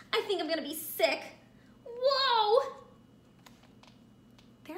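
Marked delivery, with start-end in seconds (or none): 1.8-2.7 surprised. Acted.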